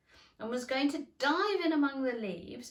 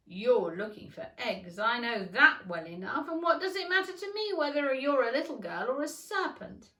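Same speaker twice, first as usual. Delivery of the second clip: louder, unlike the narrator, accusing bird; accusing pigeon; 3 semitones higher, 7 dB louder than usual. A narrator's reading. Pigeon accusing Alice, louder. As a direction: pin the charge on her.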